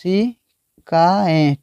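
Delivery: केशिकाएं drawn out very slowly; slow, careful. Shown as a demonstration. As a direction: stretch केशिकाएं for the students. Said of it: "केशिका बोलते हैं".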